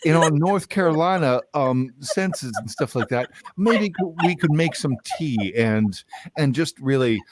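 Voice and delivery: high-pitched mock Southern voice; hammed-up Southern accent; put-on Southern accent. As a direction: play a flustered Southern type, pitched high. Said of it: "we're still like this".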